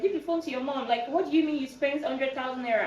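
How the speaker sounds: imitated indignant parent on the phone; furious dad. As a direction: act out an angry father demanding answers. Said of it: "he was so furious".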